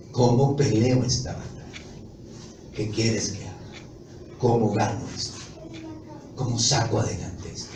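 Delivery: más baja y suave, humilde; oración humilde. Retold or imitated. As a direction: ruega postrado ante el Señor. Oración humilde postrado ante Dios. (el creyente postrado ora al Señor, en voz baja y humilde)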